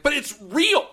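high, loud exasperated cry; exasperated outburst. Retold or imitated. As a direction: burst out in disbelief. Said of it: "And you're like".

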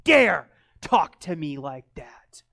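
slow furious whisper; hissed warning. Retold or imitated. Neighbor warning the pastor in a slow angry whisper. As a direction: threaten through a whisper. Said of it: "She said".

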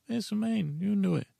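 high, cheerful sidekick voice; Sir Pounce voice. Pretend. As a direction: chirp it like an eager companion. Voicing companion Sir Pounce.

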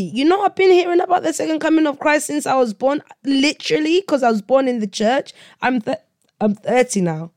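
high-pitched, fast, worked-up rush; real exasperation. Real, not acted. Speaker born in the church tells listeners of waiting, exasperated.

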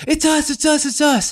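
high-pitched, urgent repeated plea; panicked pleading. Retold or imitated. Caught kids identifying themselves to frightened dad, high panicked pleading. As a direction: imitate scared kids giving themselves up. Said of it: "we all point the flashlights at our faces and we go".

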